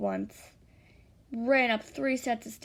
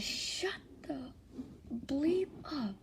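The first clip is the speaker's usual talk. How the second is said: menacing hush; 4 semitones higher, 6 dB quieter, much slower than usual. A narrator's reading. Captor silences the screaming victim.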